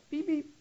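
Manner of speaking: high, soft car horn beep; tiny, courteous toot of a horn; polite little beep. Retold or imitated. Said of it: "I got a little Honda, you know, it makes this real, real polite".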